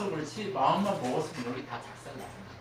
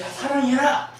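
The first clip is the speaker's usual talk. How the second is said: commanding call; 8 semitones higher, 10 dB louder than usual. Retold or imitated. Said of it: "God commanding love".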